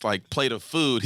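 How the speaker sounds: ordinary talk, nothing marked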